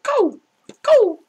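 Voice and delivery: high, loud urging shout. Real, not acted. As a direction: yell at him to move. Urging friend in game.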